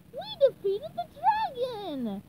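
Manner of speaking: slow, high victory cry; triumphant shout. Acted. SpongeBob celebrating the dragon's defeat, high cheer. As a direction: cheer the win. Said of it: "shouted SpongeBob".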